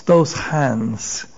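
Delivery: ordinary delivery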